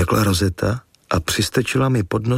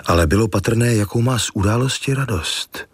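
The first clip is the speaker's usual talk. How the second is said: plain reading voice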